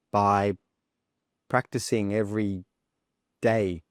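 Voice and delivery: halting delivery, long gaps every word or two; hesitant pauses. Shown as a demonstration. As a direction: stop and think between words of "I think the best way". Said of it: "pauses or hesitations like that".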